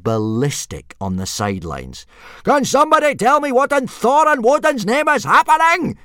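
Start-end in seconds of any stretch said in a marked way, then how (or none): 2.4-6.0 much higher, much louder enraged roar; furious bellow. Acted. Gobber bellowing at the boys and dragons, furious. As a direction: roar it across the whole field.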